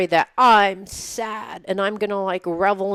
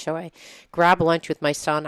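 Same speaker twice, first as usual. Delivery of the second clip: normal talking voice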